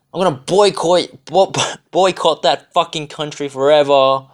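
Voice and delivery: sarcastic put-on of outraged Australians; mocking outraged boycotter. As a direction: sneer out the boycott call like an indignant tourist. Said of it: "saying shit like".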